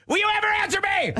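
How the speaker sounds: shrill call from another room, hammed up; imitating his wife. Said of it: "she'll mumble from another part of the house and go".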